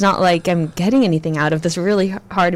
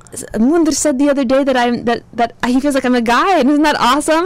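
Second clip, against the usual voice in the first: excited retelling; 5 semitones higher than usual. Retold or imitated. Retelling happy news to friend.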